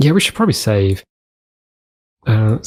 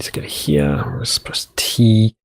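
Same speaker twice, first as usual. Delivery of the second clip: whisper; slower than usual. Real, not acted. Whispered aside.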